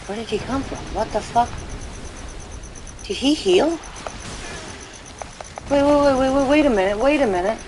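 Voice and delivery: can't believe the enemy healed, freaking out; real disbelief. Real, not acted. Player sees the same enemy back at full health, panicking in disbelief.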